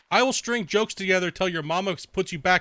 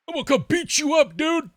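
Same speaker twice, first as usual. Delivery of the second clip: mock troll threat; 12 semitones higher, 5 dB louder than usual. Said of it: "mocking the troll's threat".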